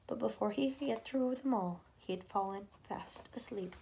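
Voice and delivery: softly whispered ending; fading whisper. A narrator's reading. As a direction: voice drops to a hush. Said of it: "narrator fades to whisper".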